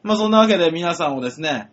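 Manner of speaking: ordinary talk, nothing marked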